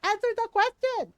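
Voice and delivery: demanding titas. Retold or imitated. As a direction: interrogate him like an outraged Filipino tita. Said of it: "And my titas are like".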